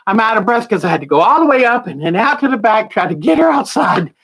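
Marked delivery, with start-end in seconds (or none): none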